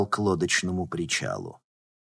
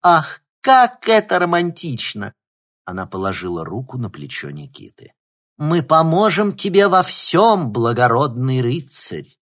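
mock romantic; 5 semitones higher, 9 dB louder than usual. Alyona mocks romantically.

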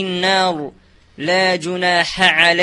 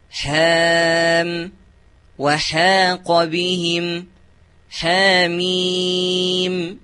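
careful recitation; slower than usual. Acted. Student recites examples.

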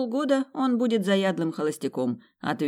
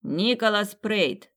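slow, louder formal announcement of a name; butler announcing; 5 semitones higher, 3 dB louder, much slower than usual. Acted. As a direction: proclaim the guest to the whole hall. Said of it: "а потом огласил".

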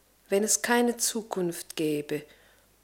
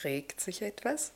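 soft teasing; 5 semitones lower, 8 dB quieter than usual. Acted. Gently teasing the soldier.

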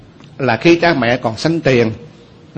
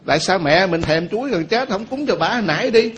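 ordinary talk, nothing marked

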